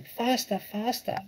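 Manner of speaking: very high, frantic urging; shouting. A narrator's reading. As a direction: urge them on in a high cry. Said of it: "and shouting".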